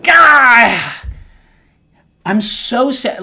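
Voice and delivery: a cry of sadness that breaks out loud, then drops; despairing cry. Retold or imitated. Admitting to Laura he is just sad, crying out. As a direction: let it burst out, then sink. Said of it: "And I'm like".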